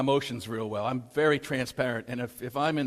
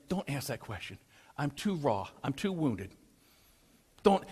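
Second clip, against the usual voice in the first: inner plea; 5 dB quieter than usual. Retold or imitated. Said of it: "And I'm thinking".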